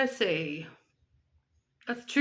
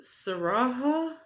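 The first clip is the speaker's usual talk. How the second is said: ordinary talk, nothing marked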